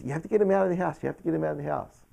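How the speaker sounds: high, repeated urgent plea, imitated; pleading insistence. Mom begs son to remove the man, urgent insistence.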